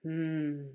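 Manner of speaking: long, grumbling hum; unimpressed growly hum; growled hmm. A narrator's reading. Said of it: "growled Handsome".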